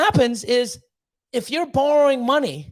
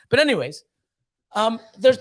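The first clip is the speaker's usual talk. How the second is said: usual speaking voice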